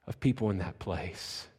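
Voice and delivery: ordinary talk, nothing marked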